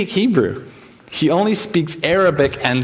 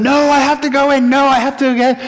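desperate pleading yell, higher; 8 semitones higher than usual. Retold or imitated. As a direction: cry out to be let in. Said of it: "starts yelling".

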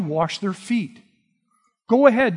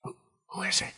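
hushed, breathy whisper to a friend; John whispering to Jesus at the table; 13 dB quieter, faster than usual. Retold or imitated. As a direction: lean in and whisper the question. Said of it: "whispers and says".

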